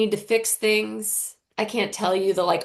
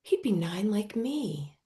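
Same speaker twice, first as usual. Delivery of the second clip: put-on light girl voice; 4 semitones higher than usual. Girl telling mom about the brother, lightly childlike. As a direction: muse on it like a delighted kid.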